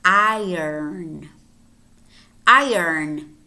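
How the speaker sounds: Iron as EYE-urn, drawn out; moved R. Shown as a demonstration. Teacher asks learners to listen carefully, Iron as EYE-urn. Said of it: "the er is not really silent, but it moves".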